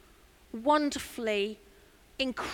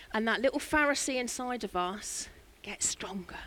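whisper; 6 dB quieter than usual. Real, not acted. Confiding warning in sermon.

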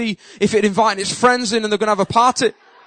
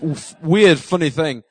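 ordinary talk

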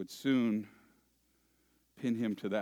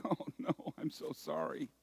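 whispered mock apology; mocking a whimpering, apologetic weeper; 6 semitones higher, 5 dB quieter than usual. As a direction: fake a feeble little sorry. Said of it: "weeping like".

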